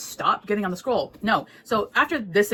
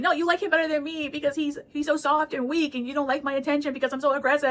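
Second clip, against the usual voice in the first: higher, whining jealous complaint; whiny jealous sulk; 7 semitones higher than usual. Retold or imitated. Jealous Emperor whining to Chuaning, higher and sulky. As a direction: pout it out like a jealous lover. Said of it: "And he's like".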